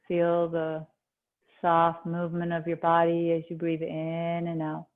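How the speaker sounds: calm meditation voice, long pauses between phrases; guided meditation. Acted. Yoga teacher easing students into breath awareness, guided meditation. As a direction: settle them gently inward, leaving space after each instruction.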